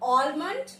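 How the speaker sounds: "almond" with a sounded L; wrong pronunciation. Shown as a demonstration. Teacher rejecting the misreading, mispronounced with L. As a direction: say "almond" as AWL-mund. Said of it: "almond नहीं".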